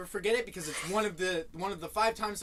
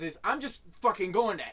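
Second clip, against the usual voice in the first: ordinary talk, nothing marked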